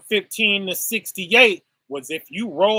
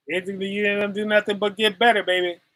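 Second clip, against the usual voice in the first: usual speaking voice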